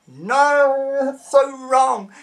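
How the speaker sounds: high, loud, slow no; mock outrage, dragging it out; disgusted protest. Real, not acted. Disgusted again.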